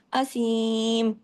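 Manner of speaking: drawn-out اسیم, light and thin; slow recitation. Shown as a demonstration. Teacher recites اسیم to the class, drawn out. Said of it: "اب یہ تمام اسیم باریک word ہے".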